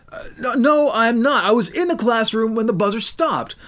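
pleading he wasn't late; protesting teen. Acted. Boy protesting lateness.

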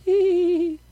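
high, loud hee-hee giggle; mock giggle. A narrator's reading. Twins reaching for the board, giggling. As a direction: giggle in a high put-on squeal. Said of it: "The girls giggled".

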